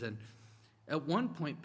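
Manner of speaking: ordinary talk, nothing marked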